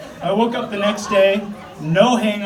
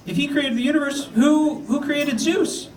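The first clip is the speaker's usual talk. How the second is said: put-on higher, slowing kid question; nephew voice; 7 semitones higher than usual. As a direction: ask it like a curious nephew. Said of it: "He's like".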